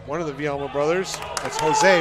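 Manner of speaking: ordinary talk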